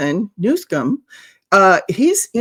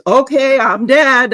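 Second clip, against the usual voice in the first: louder, higher voicing of a doomed deer; mock panicked deer; 5 semitones higher, 7 dB louder, slower than usual. Commentator voicing a deer caught in headlights, mock panic. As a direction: blurt it like a startled deer, hammed-up. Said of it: "Just like a deer thinks".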